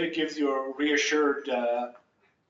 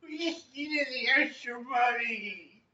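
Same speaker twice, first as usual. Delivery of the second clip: whiny actor voice; 10 semitones higher, slower than usual. A narrator's reading. Voicing the actors.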